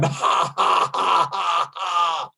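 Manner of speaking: breathy ha into shouted ha, ha; shouted ha laughs. Shown as a demonstration. Teacher leading the class, shouting ha, ha. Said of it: "And one more time".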